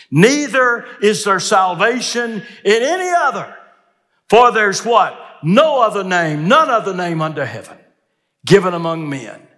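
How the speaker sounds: high, loud scripture declamation; full-force preaching. Retold or imitated. Preacher quoting Peter's testimony to listeners, full-force preaching. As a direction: proclaim the verse from the pulpit at full force.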